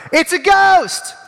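high, slow frightened cry; terrified disciples, high and drawn out; cried out in fear. Acted. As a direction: gasp it out like you've seen a ghost. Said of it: "they cried out in fear".

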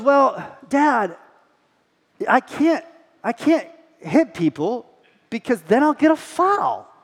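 a hint of a earnest little boy explaining; voicing his son. Son in the back seat explaining fouls, lightly childlike voice.

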